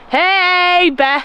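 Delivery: loud call to scare off a bear. Shown as a demonstration. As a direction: shout to warn off a bear. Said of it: "This is what the Canadian government tells you to do".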